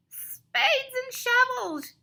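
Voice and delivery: slow, high villainous creature yell; high, slow cartoon-mole outburst; villain's yell. A narrator's reading. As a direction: draw it out in an outraged creature voice. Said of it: "yells Odious Mole".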